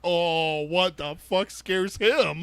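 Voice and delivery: slow, high-pitched mock dread; mock alarmed disbelief. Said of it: "And you're like".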